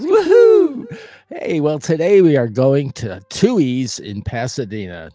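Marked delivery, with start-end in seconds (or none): 0.0-1.0 loud, very high woohoo; excited whoop. Real, not acted. Host capping the show intro, excited whoop. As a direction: let out a big high whoop.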